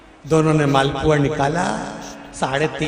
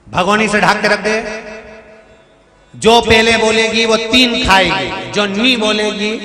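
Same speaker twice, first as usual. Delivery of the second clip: louder, higher commanding call; bossy order; 5 semitones higher, 5 dB louder than usual. Acted. Mother-in-law ordering the daughter-in-law to cover the pot, louder. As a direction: lay down the rule firmly.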